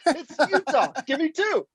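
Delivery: high-pitched insistent quote; exclaimed correction. Retold or imitated. Fan feeding the actor his line, exclaimed. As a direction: cry out the right line, high.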